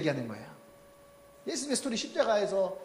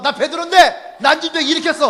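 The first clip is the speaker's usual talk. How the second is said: loud, higher boastful brag; mock bragging Peter; 6 semitones higher, 11 dB louder than usual. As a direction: crow about it like a show-off.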